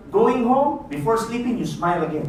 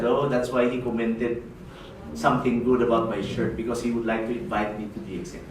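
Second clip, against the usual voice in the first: much lower, muted realisation; knowing inner thought; 10 semitones lower, 6 dB quieter than usual. Acted. Prospect thinks about the compliment after an invitation, muted. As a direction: think it aloud to yourself, low.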